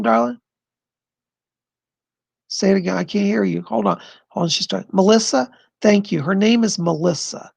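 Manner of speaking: ordinary delivery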